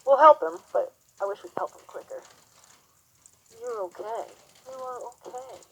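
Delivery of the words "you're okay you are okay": high, soft singsong praise; soothing praise to horse. Real, not acted. Calming the horse.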